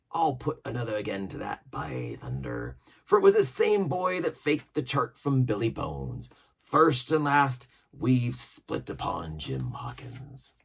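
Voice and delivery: a hint of a sly sea cook; pirate cook voice. A narrator's reading. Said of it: "added the sea cook".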